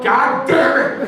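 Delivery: high, loud, stretched-out curse; exasperated shout. Retold or imitated. Husband watching his wife reverse up to the door, exasperated shout. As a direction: yell it in retold exasperation. Exasperated at wife's reckless driving.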